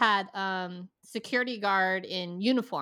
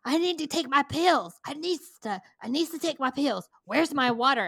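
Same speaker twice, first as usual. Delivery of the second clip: whiny demanding customer; 5 semitones higher, 3 dB louder than usual. Retold or imitated. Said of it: "He's like".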